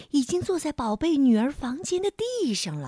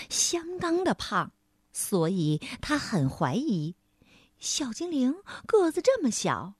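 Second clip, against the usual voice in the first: plain reading voice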